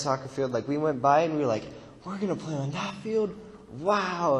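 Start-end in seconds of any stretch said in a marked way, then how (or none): 2.0-4.4 high, wide-eyed retold wonder; amazed reaction. Team passing a nice soccer field, amazed. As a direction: act out excited disbelief, pitch lifted. Retelling team's awed first reaction.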